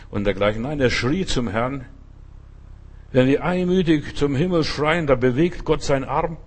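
usual speaking voice

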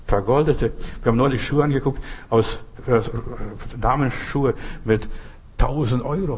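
usual speaking voice

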